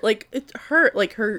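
ordinary talk, nothing marked

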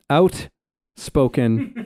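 "outspoken" in a Canadian accent, drawn out very slowly with a rising finish. Shown as a demonstration. Explaining Canadian versus American accents, giving the Canadian "outspoken" slowly. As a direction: stretch out "outspoken" the Canadian way and lift the end. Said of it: "like, the Canadian out".

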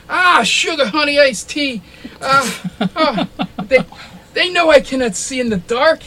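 high, loud pained yelp of a clumsy NPC; high yelping minced oath after stubbing a toe; NPC cursing. Acted.

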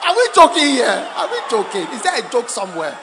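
loud challenge to the crowd; booming rhetorical scolding; mock indignation. Real, not acted. Preacher challenges the laughing crowd.

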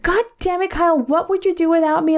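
high-pitched retold exasperation; exasperated. Telling off Kyle who missed the belt, exasperated.

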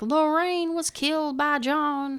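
high, slow Southern drawl imitation; imitating the ghost voice with a Southern accent. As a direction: mimic a Southern voice from a recording, slowly. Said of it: "like a southern accent".

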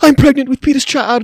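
mock dramatic announcement. Retelling her fake pregnancy line in a hammed-up high voice. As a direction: play up the fake pregnancy reveal.

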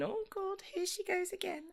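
mock the nosy neighbours in a high hushed voice; high murmuring neighbour impression; gossiping neighbours.

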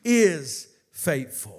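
high, loud preaching building to a shout; full-force preaching. Real, not acted. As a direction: drive it up to a roaring pulpit climax.

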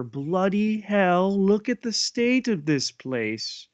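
put-on high, loud British accent. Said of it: "in a British accent here".

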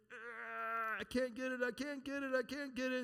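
acting out fighting a stuck jar lid, mild mock strain. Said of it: "You're straining".

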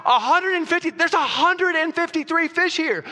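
high, loud, thrilled disciple shouting the count; high excited cry to James; excited Thomas. Retold or imitated. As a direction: burst out like a fisherman who can't believe the catch. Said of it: "Thomas is like".

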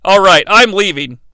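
ordinary delivery

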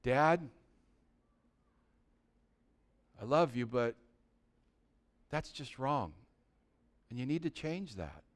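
soft, low and hesitant, with long pauses; hushed, tender reproof to dad; gentle correction. Acted. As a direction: ease carefully into correcting a parent. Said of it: "That's the way he says you need to do it".

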